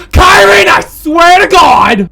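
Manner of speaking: loud, high, drawn-out shout; furious theatrical bellow; exasperated yell. Acted. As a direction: holler a threat at someone missing. Yelling at the missing character.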